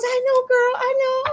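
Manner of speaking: high-pitched playful commiseration; mock commiserating. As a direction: imitate a woman wailing in agreement. Retold sympathetic lady's reply.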